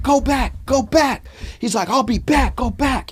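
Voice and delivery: urgent order. Retold or imitated. Wood ordering friend away.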